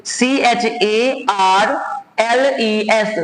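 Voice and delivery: slow, letter-by-letter spelling. Shown as a demonstration. Teacher spelling the name Charles for students, letter by letter. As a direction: dictate each letter slowly and separately.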